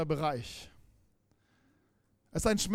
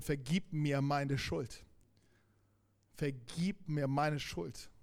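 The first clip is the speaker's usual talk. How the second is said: slow, soft, lower plea; quiet prayer; 3 semitones lower, 6 dB quieter, slower than usual. Acted. Praying at the cross for forgiveness, slow and soft. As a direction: pray it slowly under your breath. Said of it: "uns erstmal so ans Kreuz holt".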